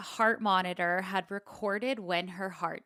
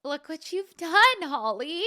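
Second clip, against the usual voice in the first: higher, louder playful blame; mock accusing; 8 semitones higher, 7 dB louder than usual. Real, not acted. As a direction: point the finger, teasing.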